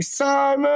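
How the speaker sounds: SIMON held long on one high, steady note; drawn-out name call. Real, not acted. Greeting a chat member by name, drawn-out high call of Simon. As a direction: stretch the last vowel of Simon right out, high. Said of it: "I say it like how I spell it".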